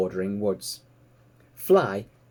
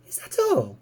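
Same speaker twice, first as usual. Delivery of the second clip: eager piglet; 11 semitones higher than usual. Acted. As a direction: squeak it out like a curious piglet. Piglet Babe unimpressed.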